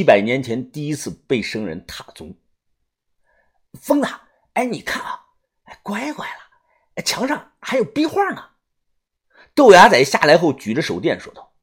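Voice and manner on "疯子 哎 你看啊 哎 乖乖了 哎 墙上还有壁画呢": high, hushed wonder; marvelling young digger. Acted. Young digger spotting murals in the tomb, hushed amazement. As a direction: breathe it high and half-whispered, amazed.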